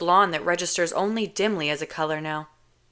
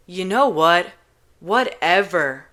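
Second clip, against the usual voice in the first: sighing dismissal; 6 dB louder, slower than usual. Acted. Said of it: "he sighs".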